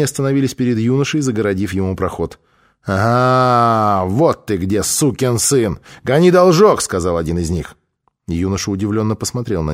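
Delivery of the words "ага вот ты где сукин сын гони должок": thug cornering a debtor, building louder; menacing, rising to a shout; threatening demand. Acted. Thug confronts youth over a debt.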